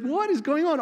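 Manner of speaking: high, loud indignant protest; mock outrage. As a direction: challenge him in hammed-up exasperation. Acts out outraged challenge to Micaiah.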